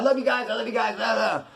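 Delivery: high, sing-out imitation of gushing love; mock gushing. Mimicking the unpredictable dude hugging everyone, mock gushing. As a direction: mimic someone overflowing with affection, trailing into nonsense.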